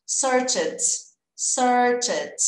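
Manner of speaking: "searched" as one syllable, search plus a quick T; T sound added. Shown as a demonstration. Teacher modelling the past of search for students, clipped T. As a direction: say "searched" ending in a light T, no E. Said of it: "acrescentar um som de T".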